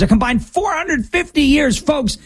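indignant emphasis. Real, not acted. High rhetorical emphasis.

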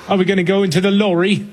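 high, quick mock British kid voice; British child accent. Playing a kid who learned from Peppa Pig, British accent. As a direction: chirp it in British English through laughter. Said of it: "speaking in British accents".

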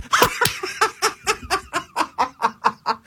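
high real laugh, cracking up; burst of laughter. Real, not acted. Laughing at the AI's joke.

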